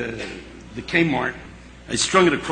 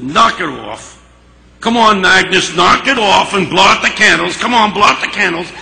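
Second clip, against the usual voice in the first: gruff urging; 6 semitones higher, 9 dB louder than usual. Retold or imitated. Harry urging the crying Agnes to blow out candles. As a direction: coax her roughly but kindly.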